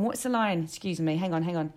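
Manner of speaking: normal talking voice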